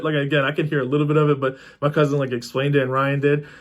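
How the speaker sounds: usual speaking voice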